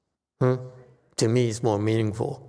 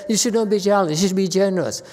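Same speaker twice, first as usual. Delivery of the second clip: higher, mock-preachy recital of the old maxim; preachy Chinese teaching; 8 semitones higher, 3 dB louder, faster than usual. Master mocking what Chinese teachers tell students, lightly preachy. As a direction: parrot the moralizing teachers a bit higher. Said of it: "The Chinese, they say".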